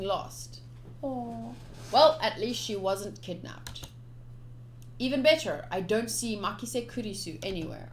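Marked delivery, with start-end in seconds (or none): none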